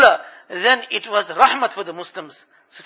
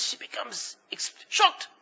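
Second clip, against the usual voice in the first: ordinary talk, nothing marked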